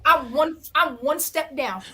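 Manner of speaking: ordinary delivery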